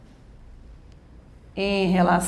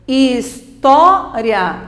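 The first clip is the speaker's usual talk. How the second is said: "História" slowly, with TÓ stretched and loud; slow his-TÓ-ria, hitting the middle; stressed syllable demo; 5 dB louder, much slower than usual. Shown as a demonstration. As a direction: lean hard on TÓ in "História". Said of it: "essa é a tônica".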